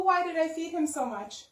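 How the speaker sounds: plain reading voice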